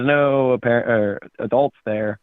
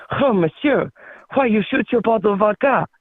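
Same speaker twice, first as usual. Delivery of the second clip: put-on higher-pitched, protesting French accent; 10 semitones higher than usual. French guy freaks out at the shot vodka bottle, French accent. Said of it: "That's good French".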